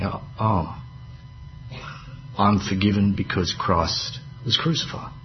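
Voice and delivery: soft awed realisation; dawning relief, hushed. Pretend. Preacher voicing a believer who just got it, soft and awed. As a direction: breathe it out as it sinks in. Penny drops, sinner realises forgiveness.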